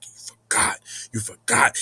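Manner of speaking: low, dark accusing voice; getting dark. Retold or imitated. Spirits accusing him of forgetting, turning dark. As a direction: darken it and drop the pitch. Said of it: "it start getting dark".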